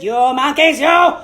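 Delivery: loud, furious demand; shouting peddler. Acted. Peddler very angry, stamping both feet and shouting at monkeys. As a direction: really yell it, very angry now. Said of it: "He stamped both his feet and shouted".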